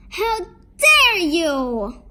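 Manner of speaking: loud, high, drawn-out outrage; angry shout. A narrator's reading. Girl finds a witch with her picnic basket, angry shout. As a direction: shout it slowly, stretching the outrage. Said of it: "Kate was very angry".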